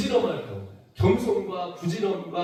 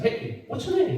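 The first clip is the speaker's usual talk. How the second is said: put-on American accent; 6 semitones higher, much slower than usual. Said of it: "미국 사람이라서".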